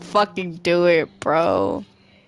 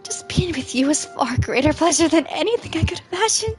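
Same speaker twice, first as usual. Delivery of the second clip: put-on obsessive lovesick girl, higher; Yuri voice; 4 semitones higher than usual. Player voicing Yuri confessing to Zale, lovesick higher voice. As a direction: voice her spiralling confession, pitched up.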